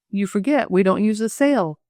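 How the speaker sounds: ordinary delivery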